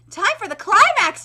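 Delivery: usual speaking voice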